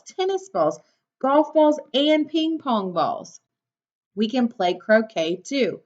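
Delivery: normal talking voice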